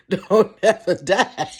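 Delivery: ordinary delivery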